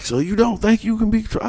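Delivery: high mock principal voice, prodding; imitating the principal. Principal baiting a student about control, high mock voice. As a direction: put on a high, needling authority voice. Principal quoted pressing the boy.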